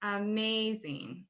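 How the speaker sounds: "amazing" slowly, MAZ drawn out; stressed syllable. Shown as a demonstration. Teacher repeats the example word, stressed middle of "amazing". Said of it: "stretched out a little bit longer".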